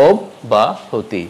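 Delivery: অব্যাহতি slowly in parts, "ob-ba-hoti"; slow, syllable-by-syllable অব্যাহতি; slow correct pronunciation. Shown as a demonstration. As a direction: break up অব্যাহতি, rounded "o" first. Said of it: "হবে".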